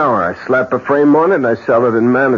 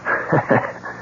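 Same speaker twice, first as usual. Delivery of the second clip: breathy chuckle; 3 semitones higher, 6 dB quieter than usual. Acted. Man laughs at startled guest.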